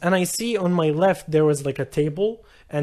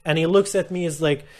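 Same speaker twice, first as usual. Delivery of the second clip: usual speaking voice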